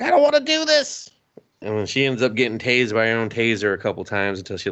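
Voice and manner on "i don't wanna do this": high, whiny put-on voice; reluctant girl. Imitating the girl who doesn't want to act, whiny and high. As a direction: whine it up high. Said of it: "And she's just like".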